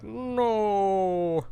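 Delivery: joking imitation of a movie villain's cry; Darth Vader no. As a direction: ham up the famous anguished no. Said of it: "like, the Darth Vader".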